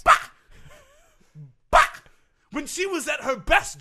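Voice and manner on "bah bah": short, explosive 'bah'; imitated tennis grunt. Coach showing how she went by the end, imitated explosive grunt. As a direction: burst out a sudden grunt. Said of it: "that's what she sounded like".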